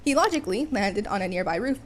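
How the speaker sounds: usual speaking voice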